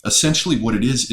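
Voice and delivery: ordinary talk, nothing marked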